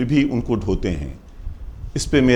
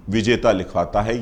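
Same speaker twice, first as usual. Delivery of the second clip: normal talking voice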